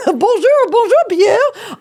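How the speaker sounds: very high, loud French greeting; playful French accent. Pretend. Host greeting the dog Pierre, high hammed-up French accent. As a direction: squeal a drawn-out greeting in mock French. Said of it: "maybe they'll speak French to each other".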